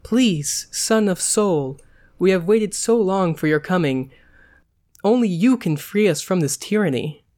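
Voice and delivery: acted imploring appeal; almost convincing supplication. A narrator's reading. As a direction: plead like a captive princess, slightly overdone. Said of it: "looked at him with almost convincing supplication".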